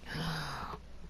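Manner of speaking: soft acted gasp; small intake of dismay; mock shocked gasp. Embarrassed guy being stared at in lobby, soft mock gasp.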